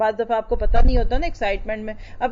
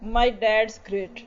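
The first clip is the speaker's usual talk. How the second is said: put-on slow child quote; much slower than usual. Voicing four-year-old child.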